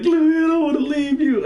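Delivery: very high mock-tragic wail; retold desperate cry. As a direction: melodramatic refusal to leave a dying comrade. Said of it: "I'm screaming out to Louis".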